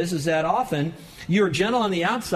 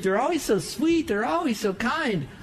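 mock sweet praise; 8 semitones higher than usual. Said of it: "those people that you think".

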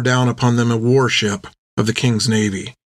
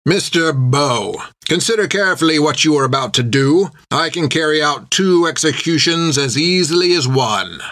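dangerously metered; 4 semitones higher than usual. A narrator's reading. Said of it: "Creech's voice was dangerously metered".

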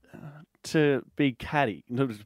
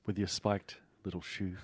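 mock seductive; 6 semitones lower, 10 dB quieter than usual. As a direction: purr it like a come-on.

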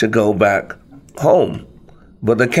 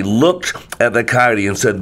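ordinary delivery, nothing marked

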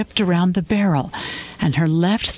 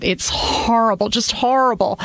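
high, shaken repetition of horrible; dismayed; 6 semitones higher than usual. A narrator's reading. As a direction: let the horror creep into the voice. Wife dismayed by case.

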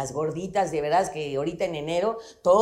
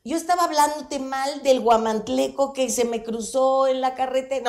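usual speaking voice

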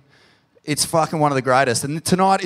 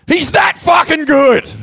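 higher, louder and slower, belting it out; belted song line; 13 semitones higher, 6 dB louder, slower than usual. Shown as a demonstration. Host teaching the crowd the chorus, belted shout. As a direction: shout it like a stadium chant. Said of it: "I'm pretty sure the next line is".

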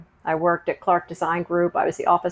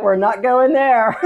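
flat no, louder and slow; firm refusal; 4 semitones higher, 7 dB louder than usual. Retold or imitated. Mother to her boys after the call, firm refusal. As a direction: put your foot down, half laughing. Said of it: "I was like".

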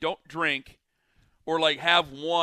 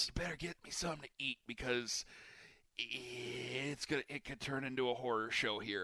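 much softer, low mock warning; quiet warning; 4 semitones lower, 13 dB quieter than usual. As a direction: hush it like a grim warning. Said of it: "And she's like".

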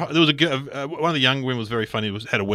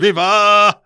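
loud high crooned shout; 10 semitones higher, 12 dB louder than usual. Acted. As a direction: belt it like the King. Belting the [name] line.

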